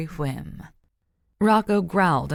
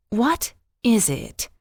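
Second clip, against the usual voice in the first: slow, higher snarling question; snarled; 5 semitones higher, slower than usual. A narrator's reading. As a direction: snarl it at the dog, drawn out. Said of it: "she snarled at him".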